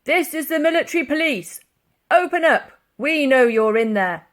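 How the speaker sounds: loud, high, barking military policeman; a policeman demanding entry; stern police officer. A narrator's reading. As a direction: shout through the door like an officer. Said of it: "came a voice from the other side".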